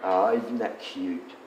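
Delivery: tender aww at sleeping puppies; soft cooing. Real, not acted. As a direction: coo over the puppies gently.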